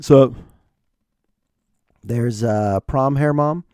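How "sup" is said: mock cool-dad sup. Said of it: "cool dad's just like".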